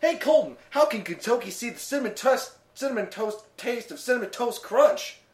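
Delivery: high, bright ad voice; commercial voice. Pretend. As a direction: pitch it like a cereal ad. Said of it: "It's how the commercial goes".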